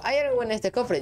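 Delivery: hopeful question, pitched well up; high-pitched question. Real, not acted. Player asks what the chest holds, high-pitched question.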